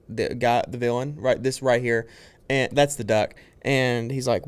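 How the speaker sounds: ordinary talk, nothing marked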